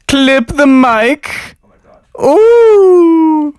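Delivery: very high, loud mock squeal. Loud test of the mic levels.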